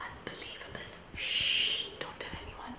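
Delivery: secretive whisper, no voice at all. Real, not acted. Sneaking into a room, whispering to her viewers. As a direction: hush it like sharing a secret. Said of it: "So why am I whispering?".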